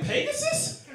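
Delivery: very high, breathless amazed voice; mock awe. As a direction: gasp like seeing a mythical beast. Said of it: "I'm looking behind me in line like".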